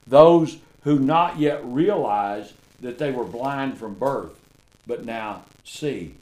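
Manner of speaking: loud, slow, drawn-out preaching; preaching at full force. Real, not acted. Preacher to congregation on being in Christ, full-force preaching. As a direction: lean into each line like a revival sermon.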